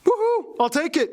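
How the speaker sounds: high, delighted whoop; excited cheer. Acted. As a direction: cheer like you won. Romania cheers at the terms.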